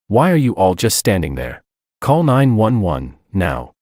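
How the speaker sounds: usual speaking voice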